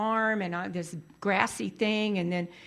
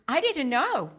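ordinary delivery

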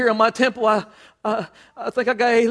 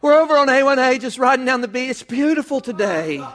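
sudden sweet phone voice; 3 semitones higher than usual. Acted. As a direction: gush to the caller as if nothing's wrong. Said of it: "all of a sudden the phone rings".

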